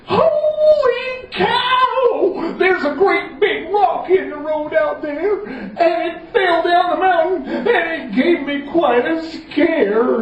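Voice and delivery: hammed-up panic; wailing Common John. Common John tells the king about the rock, sung-out panic.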